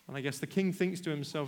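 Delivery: ordinary talk, nothing marked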